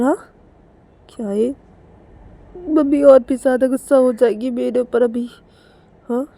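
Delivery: put-on high-pitched anxious girl voice; high, nervy thinking aloud; worried Kajal.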